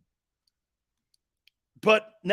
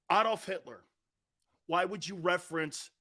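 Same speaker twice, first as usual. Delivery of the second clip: usual speaking voice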